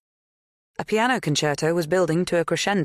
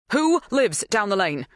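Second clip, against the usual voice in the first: urgent, higher-pitched demand; bursting out; 6 semitones higher, 3 dB louder than usual. A narrator's reading. Helen questioning the farmhand, bursting out. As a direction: blurt it out, unable to hold back. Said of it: "Helen burst out, as if she couldn't contain herself".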